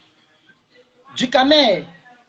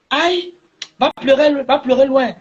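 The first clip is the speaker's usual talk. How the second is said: moquerie aiguë; 6 semitones higher than usual. Real, not acted. Moquerie criée à l'adversaire.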